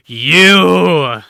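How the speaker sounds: loud pointed shout; booming accusation. Acted. Father spotting his daughter in the doorway, booming shout. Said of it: "he'll point his finger towards you, and he'll say".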